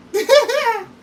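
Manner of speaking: high laugh through tears; emotional laugh; teary laugh. Real, not acted. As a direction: laugh at your own tears. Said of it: "finna cry".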